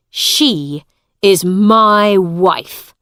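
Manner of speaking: loud angry warning. A narrator's reading. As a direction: snap it at him with anger. Hugh's angry claim continues.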